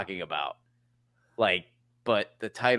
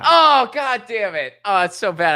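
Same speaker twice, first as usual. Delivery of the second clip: exasperated outburst; 8 semitones higher, 7 dB louder than usual. Real, not acted. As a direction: curse yourself loudly for missing it. Kicks self over missed answer.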